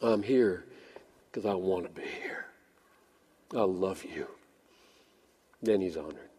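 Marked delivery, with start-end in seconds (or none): 0.0-4.3 low, soft, tender confession; sincere devotion. Acted. Worshiper telling God of wanting to be here, low and tender. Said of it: "you say to him".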